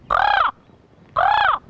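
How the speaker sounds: loud, high caws, spaced apart; answering in crow. Retold or imitated. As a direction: give two separate, full-force crow caws. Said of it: "I said".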